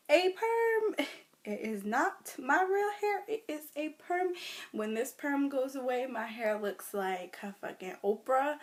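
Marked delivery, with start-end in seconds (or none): 0.1-4.3 sassy. Retold or imitated. Sassy reply about her perm.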